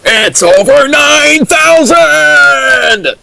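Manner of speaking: high, slow, stretched scream of the line; meme anime yell. Pretend. Co-host answering the power level question as Vegeta.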